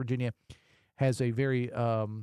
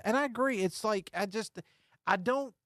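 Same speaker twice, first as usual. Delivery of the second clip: normal talking voice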